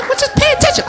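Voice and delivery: very high and loud retort; snippy girlfriend. Retold or imitated. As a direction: cut him off sharply.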